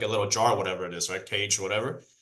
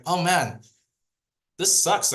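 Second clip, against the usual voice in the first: put-on disappointed kid; 6 semitones higher, slower than usual. Boy upset about cocoon.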